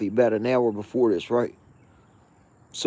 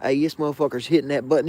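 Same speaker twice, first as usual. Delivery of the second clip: ordinary talk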